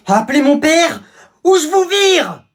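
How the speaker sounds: high, very loud tantrum, slowed; a rich kid's raging tantrum at his servant; furious spoiled threat. Acted. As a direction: scream the firing threat like a spoiled brat.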